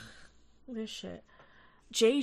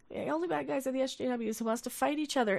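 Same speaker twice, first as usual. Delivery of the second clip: ordinary talk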